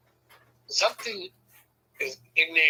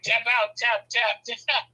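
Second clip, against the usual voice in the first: higher, repeated surrender; mock urgent plea; 7 semitones higher, slower than usual. Husband tapping out of wife's hold, mock urgent plea. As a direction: plead to be let go. Retold begging to tap out.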